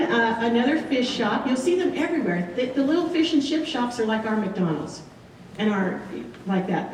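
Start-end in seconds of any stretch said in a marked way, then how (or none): none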